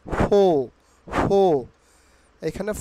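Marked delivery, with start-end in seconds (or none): 0.1-1.4 loud "ফো", open O and no R; "ফো" punched out with the R silent; R-less pronunciation. Shown as a demonstration. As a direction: repeat "ফো" loudly as foh. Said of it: "উচ্চারিত হবে ফো".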